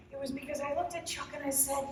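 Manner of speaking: usual speaking voice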